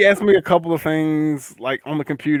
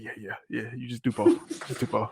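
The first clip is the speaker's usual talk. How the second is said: low, much softer brush-off; dismissive agreement; 5 semitones lower, 11 dB quieter than usual. Retold or imitated. Retelling his reply to a coworker, quietly dismissive. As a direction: murmur it to get rid of someone.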